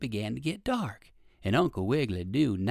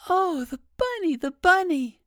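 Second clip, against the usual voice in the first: put-on much higher, louder child's cry; excited little boy; 8 dB louder than usual. A narrator's reading. Little boy spots the rabbit in the car, excited high cry. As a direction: squeal with delight like a small boy. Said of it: "cried the little boy".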